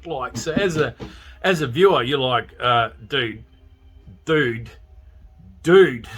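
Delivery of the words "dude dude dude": building warning call. Retold or imitated. Yelling at oblivious driver.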